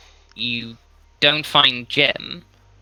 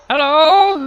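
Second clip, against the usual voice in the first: tiny high wavering voice; small shaky voice, pitched way up; little wavering voice; 7 dB louder than usual. Acted. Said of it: "you hear a little voice wavering".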